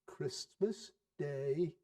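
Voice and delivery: soft and very slow, gently pleading; calm his wife down with a gentle reminder; slow, soft, mild husband; mild answer. Acted. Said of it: "was Bob's mild answer".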